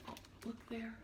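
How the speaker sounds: ordinary delivery, nothing marked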